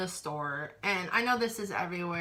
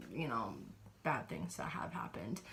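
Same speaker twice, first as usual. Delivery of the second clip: whisper; 10 semitones lower, 11 dB quieter than usual. Real, not acted. Admitting bad things at Starbucks in a whisper. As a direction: drop to a hushed whisper.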